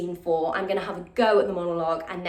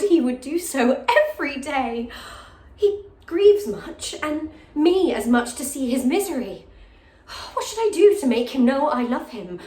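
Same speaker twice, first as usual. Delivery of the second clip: higher, impassioned Shakespearean soliloquy with long dramatic pauses; lovesick jailer's daughter; 5 semitones higher, 6 dB louder than usual. Acted. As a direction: confide a hopeless crush, swelling into louder outbursts. Continues the monologue.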